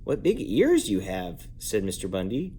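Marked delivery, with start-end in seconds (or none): 0.0-1.5 slow wondering. A narrator's reading. Mister Bundy wonders at grandmother.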